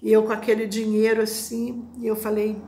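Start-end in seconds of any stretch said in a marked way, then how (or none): none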